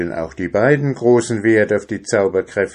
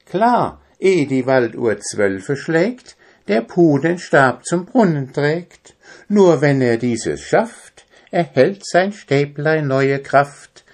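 high, lilting animal verse; Quaki's rhyming voice; 6 semitones higher, 4 dB louder than usual. Acted. Quaki answers the dwarf with more verse, high rhyming voice. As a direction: chant the rhyme eagerly, pitched high.

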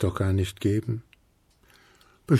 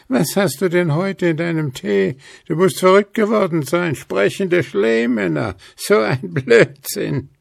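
much higher ghost voice, scoffing; mocking ghost; 11 semitones higher, 4 dB louder than usual. A narrator's reading. Ghosts mock Willi's talking snowman, scoffing. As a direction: laugh him off as crazy.

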